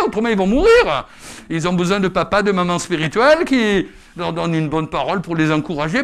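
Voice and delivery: loud, high exhortation; full-force preaching. Acted. Preacher urging members to care for new converts, loud and urgent.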